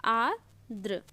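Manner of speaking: आर्द्र in two parts, "aar... dra"; broken into parts. Shown as a demonstration. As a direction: split आर्द्र for dictation. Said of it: "आर्द्र ऐसे लिखते हैं".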